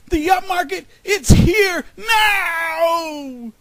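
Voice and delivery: huge, high shout, last word drawn out; full shout. A narrator's reading. Groundhog announcing the green sprout, full shout. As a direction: yell it at full force, stretching the end. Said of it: "Then he shouted".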